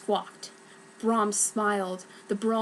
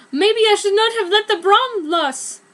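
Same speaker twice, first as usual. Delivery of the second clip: higher and louder, distressed; panicked regret; 10 semitones higher, 8 dB louder than usual. Acted. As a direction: cry it out in remorse.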